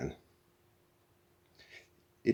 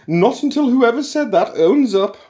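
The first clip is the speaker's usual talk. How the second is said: much higher, much louder, bright motherly call; matriarchal computer; 16 dB louder than usual. A narrator's reading. Ship computer greeting the crew, loud high matriarchal call. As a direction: greet them like a bossy mother. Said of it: "urged the computer".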